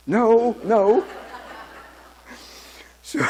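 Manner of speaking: "No, no" laughed out high and loud; cracking up as you say "No, no"; laughing. Retold or imitated. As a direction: burst out laughing through the words. Laughing while recalling the nurse.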